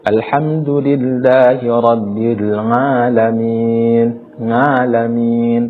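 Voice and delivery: slow chanted recitation turning "alamin" into "ngalamin"; ain as nga. Shown as a demonstration. Teacher imitating a regional mistake, nga for ain. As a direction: draw it out, nasal "ng" opening "alamin". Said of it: "dibaca dengan bunyi nga".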